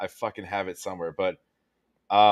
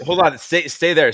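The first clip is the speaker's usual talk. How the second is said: louder and higher, calling her to hold on; raised voice calling out; 5 semitones higher, 6 dB louder than usual. Real, not acted. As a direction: call out quickly to stop her. Stopping guest to fix her audio.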